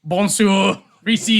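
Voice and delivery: loud hammed French greeting; French accent. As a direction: belt it like a bad Frenchman. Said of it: "my horrible French and French accent".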